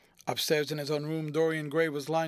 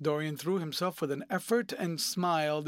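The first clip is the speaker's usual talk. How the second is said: ordinary delivery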